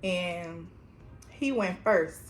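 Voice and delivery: ordinary talk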